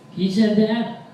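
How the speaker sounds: high, loud outraged question; indignant disbelief. Acted. Boyfriend defending upset girlfriend, high indignant outburst. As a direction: shoot the voice up in mock outrage.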